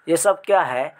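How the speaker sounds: ordinary talk, nothing marked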